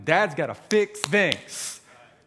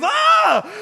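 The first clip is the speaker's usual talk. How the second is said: high, loud squeal of disgust; overdramatic shriek; 7 dB louder than usual. Retold or imitated. Dad shrieking as the sewer cat weaves through his legs, loud squeal. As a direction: shriek as the cat brushes past. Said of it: "I'm overdramatic about everything".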